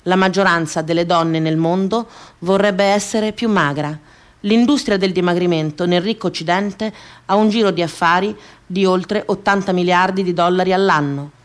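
ordinary talk, nothing marked

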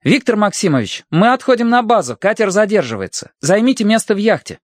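high formal announcement; loud and official. A narrator's reading. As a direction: address him loudly by the book. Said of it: "Громко и официально Словко сказал".

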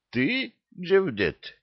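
high, slow, astonished question; drawn-out disbelief; surprised. A narrator's reading. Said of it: "удивился Махмуд".

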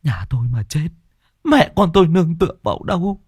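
high, tearful wail for her husband; desperate wife's lament; grieving lament. Acted. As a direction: cry out to him in despair. Wife laments her dying husband.

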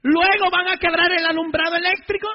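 retold furious priest giving orders; furious command. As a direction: bark the order at the gang leader. Priest retells ordering gang leader.

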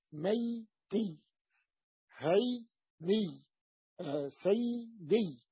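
"ميتي، هيني، سيدي" very slow in two beats; nisba ending demo. Shown as a demonstration. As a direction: split "ميتي، هيني، سيدي" before the final iyy. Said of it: "وأضفنا ياء النسب وكسرنا ما قبل ياء النسب". (نطق "ميتي، هيني، سيدي" مقطعة ببطء)

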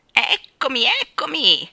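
loud, high, brash call; rude shout. A narrator's reading. Starling back at the window, brash shout. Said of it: "gridò una voce rude".

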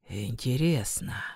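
soft, low whisper; almost a whisper. A narrator's reading. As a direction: barely whisper it. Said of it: "Он сказал почти шёпотом". (почти шёпотом)

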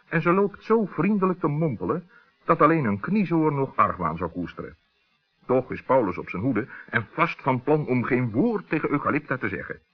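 usual speaking voice

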